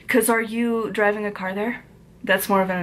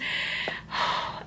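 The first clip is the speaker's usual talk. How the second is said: long unvoiced sigh; frustrated sigh; 8 dB quieter than usual. Real, not acted. Vlogger sighing at a hot button topic. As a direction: breathe out in exasperation.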